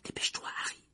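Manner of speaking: voiceless urgent whisper; barely mouthed. A narrator's reading. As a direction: breathe it almost without sound. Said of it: "en remuant simplement les lèvres". (articulé sans voix, pressant)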